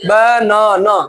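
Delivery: बनाना stretched into ब... ना... ना; slow, even beat on each syllable of banana; broken into syllables. Shown as a demonstration. Teacher checking class understood syllables, banana split by syllable. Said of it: "कितना बार हम तोड़ के बोलते".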